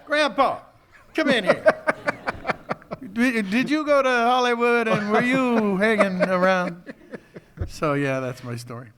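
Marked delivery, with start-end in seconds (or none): 0.1-6.9 put-on much higher, louder child's voice; grandkid. Grandkid calling grandpa in from the TV, in a high child's voice. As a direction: call out like an excited little grandkid.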